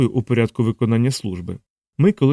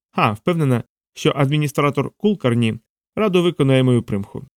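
usual speaking voice

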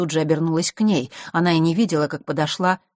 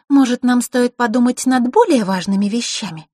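put-on high, louder girl's voice; interrupting girl; 8 semitones higher, 6 dB louder than usual. A narrator's reading. Girl interrupts the boys' quarrel, high and firm.

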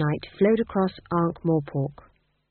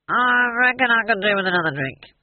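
put-on drunk dwarf; 5 semitones higher, 4 dB louder than usual. A narrator's reading. Drunk dwarf abandoning his thought for another drink, slurred. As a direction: give up thinking and slur it out. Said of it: "finally gave up and substituted".

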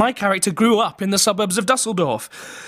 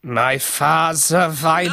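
put-on slow, drawn-out German-accented opening; slower than usual. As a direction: start the line slowly in a German accent.

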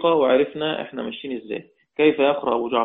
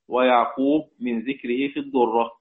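ordinary talk

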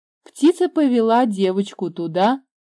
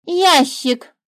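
higher, louder bird voice; the bird playing the word game; 7 semitones higher, 5 dB louder than usual. A narrator's reading. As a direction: chirp the word out. Bird's voice.

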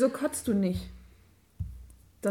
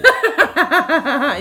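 loud burst of laughter; 4 semitones higher, 14 dB louder than usual. Real, not acted. Laughs at insult.

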